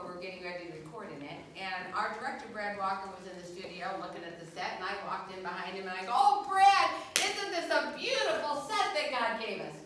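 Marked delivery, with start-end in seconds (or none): 6.1-9.8 high, loud rapture over the new set; gushing delight. Retold or imitated. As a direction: gush like it's a gift from heaven. Gushing to director about new set.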